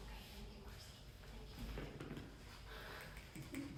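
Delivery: very soft, barely voiced thanks; under-the-breath aside; hushed aside. Real, not acted. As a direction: murmur a private thank-you away from the class. Private aside to someone during class.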